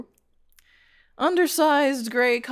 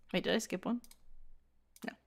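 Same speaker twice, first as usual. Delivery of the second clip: usual speaking voice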